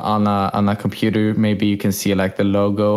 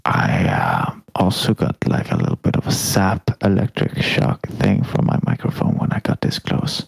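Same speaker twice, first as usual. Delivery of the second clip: voiceless close-mic whisper; hushed mock-ASMR aside; 2 semitones lower than usual. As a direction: tell it all in a whisper. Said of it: "you need to whisper".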